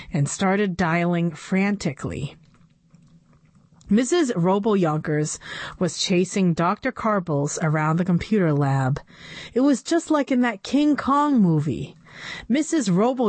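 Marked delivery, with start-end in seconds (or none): none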